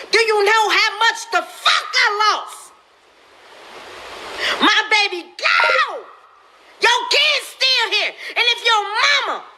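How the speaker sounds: shouted outrage at people online; high, shouted rant. Real, not acted. A mother on a live video shouting at viewers.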